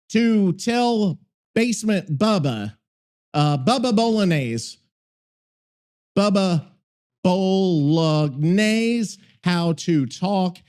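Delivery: slow, halting dictation; slow delivery with long gaps between phrases; dictating. Real, not acted. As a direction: spell out a prompt piece by piece. Dictating a prompt to the AI.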